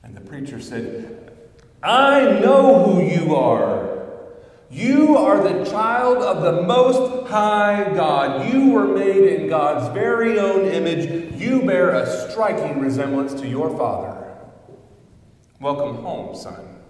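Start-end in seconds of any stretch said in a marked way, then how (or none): 1.8-14.2 loud, high, slow declaration; booming, rising pulpit declaration; preacher's proclamation. Acted. 15.6-16.8 tender welcome. Acted. Preacher's tender close.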